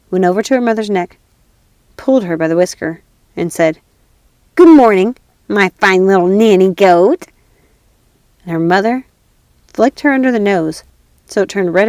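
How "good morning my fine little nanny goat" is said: loud, teasing slow greeting; mocking greeting. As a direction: tease the old woman. Mock greeting to mother.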